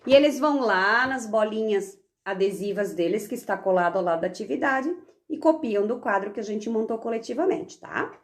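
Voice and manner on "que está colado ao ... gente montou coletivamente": usual speaking voice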